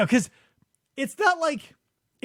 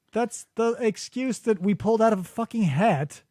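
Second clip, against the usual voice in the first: ordinary talk, nothing marked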